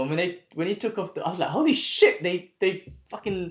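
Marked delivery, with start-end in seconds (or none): 1.5-3.5 high-pitched stunned outburst, retold; retold shock. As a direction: relive the shock, voice jumping up high. Retells shock at the reveal.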